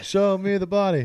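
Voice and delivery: high, loud mock-dramatic demand; playful shouted demand for proof. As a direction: call it out like a catchphrase. Playfully demanding proof, quoted catchphrase.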